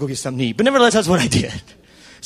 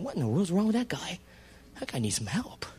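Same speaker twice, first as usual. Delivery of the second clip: inner grumble; 9 dB quieter than usual. Retold or imitated. Preacher voicing his snide thought about Joe. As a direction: think it sourly to yourself.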